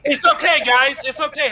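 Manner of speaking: hammed-up comforting; mock reassurance. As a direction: jokingly soothe the crowd over the hubbub. Mock consoling over studio acquisition.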